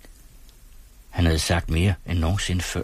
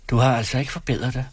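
cold; 6 semitones higher, faster than usual. A narrator's reading. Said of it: "sagde hun koldt".

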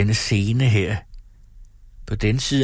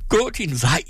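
higher, menacing cry; threatening shout; 8 semitones higher than usual. Acted. Wife to husband, threatening him away, high. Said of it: "råbte hun truende".

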